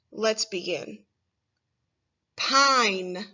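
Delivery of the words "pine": said on its own, slow and clear; clear test word. Shown as a demonstration. Teacher saying a test word for children to listen to, clearly. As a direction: present the word for careful listening. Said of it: "get ready to listen for the middle I sound".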